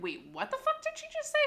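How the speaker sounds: mock shocked inner voice; stunned disbelief. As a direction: react in total disbelief.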